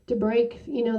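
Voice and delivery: usual speaking voice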